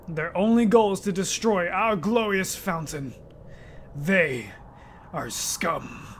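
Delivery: low, soft, menacing king; king father. Acted. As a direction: speak down to his son coldly.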